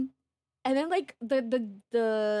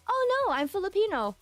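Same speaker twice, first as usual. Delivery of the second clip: high-pitched correction; retold bright denial; 9 semitones higher, 4 dB louder than usual. Passenger correcting the Korean lady, high-pitched denial. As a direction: pitch it up as a quick polite correction.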